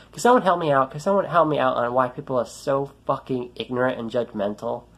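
ordinary talk

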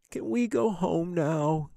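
put-on high, drawn-out kid's whine; whining. A narrator's reading. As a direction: whine it like a bored, sulky boy. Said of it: "he whined".

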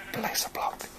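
voiceless, hushed murmur; whispering. Real, not acted. Player talking through placing a block, whispering. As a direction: whisper it under your breath.

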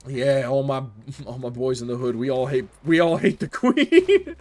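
a hint of [name], cracking up at the end; [name] impression. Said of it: "what if [name] was just like".

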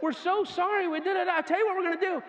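high, loud, thrilled announcing; excited pastor voice. Retold or imitated. Pastor loudly telling the crowd they will preach twice. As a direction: pastor gushing to the crowd with new plans. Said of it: "He's like".

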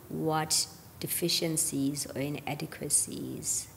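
ordinary talk, nothing marked

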